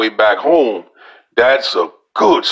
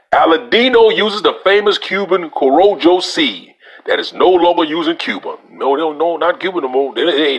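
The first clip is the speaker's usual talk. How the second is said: reading aloud; 5 semitones higher than usual. Acted. Reviewer reading a cigar website to viewers, raised reading voice. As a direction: read the website out like an announcement.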